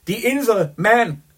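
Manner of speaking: high, slow, loud mocking repeat; mocking laugh. A narrator's reading. Gallagher mocks Chandler's Isle of Man trip, laughing. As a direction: scoff at the tiny trip. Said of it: "Ignatius Gallagher lachte".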